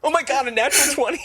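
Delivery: high, rushed, breaking into laughter; real elated disbelief. Real, not acted. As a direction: burst out over a lucky roll. Player rolls a natural twenty.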